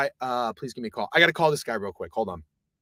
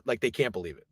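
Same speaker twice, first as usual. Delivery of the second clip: ordinary delivery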